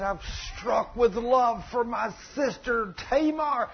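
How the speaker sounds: hammed-up pining, drawn out; lovesick. Stepbrother confesses his love to his cousin, lovesick.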